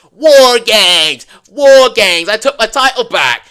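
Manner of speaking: loud mock Rhea Ripley war cry; yelling. Vlogger imitating Rhea Ripley yelling in the ring, loud. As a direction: shout it out like a brawler entering. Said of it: "she yells".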